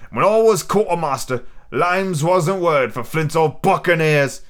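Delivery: pirate cook, boastful. Acted. Silver boasts of his standing to a young recruit, swaggering. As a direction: play a proud pirate quartermaster.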